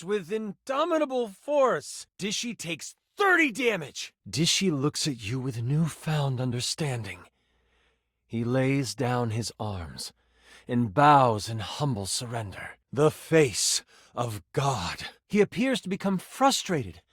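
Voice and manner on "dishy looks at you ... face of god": solemn narration. Acted. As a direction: narrate a moment of revelation in a low register. Solemn story climax narration.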